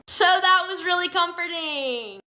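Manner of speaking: high-pitched mock cheer; sarcastic. Real, not acted. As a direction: say it brightly and mean the opposite. Sarcastic comment on the danger.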